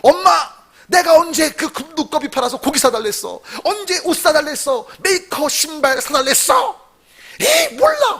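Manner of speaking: acted son blowing up at mom; high-pitched indignant protest; angry outburst. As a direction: play a petulant son lashing out. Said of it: "버럭 화를 내는 거예요".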